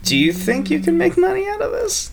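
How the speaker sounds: very high-pitched, doubtful query; incredulous question. Real, not acted. Game master challenging the player's reason for spending fate, incredulous. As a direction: question it in rising disbelief.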